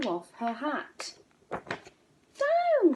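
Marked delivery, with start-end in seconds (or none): 1.5-2.9 high shrill cry of Down; the witch yells to land; witch cries out. A narrator's reading. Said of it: "cried the witch".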